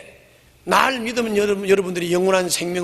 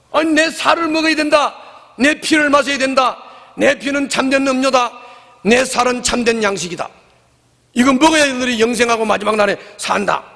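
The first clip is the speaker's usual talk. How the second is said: higher, proclaiming with weight; solemn declaration; 7 semitones higher than usual. Acted. Jesus proclaiming to the crowd, raised and solemn. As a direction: proclaim each line as a command. Preacher replays Jesus's words.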